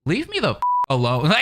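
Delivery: high-pitched exasperated mock plea. Replying to friends who trash on you, exasperated. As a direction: act fed up, voice jumping way up.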